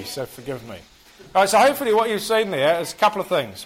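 normal talking voice

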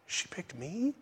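very soft, rising question; barely voiced, wondering question; hushed joyful disbelief. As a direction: ask it in quiet amazement. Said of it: "note his joy that she chose him".

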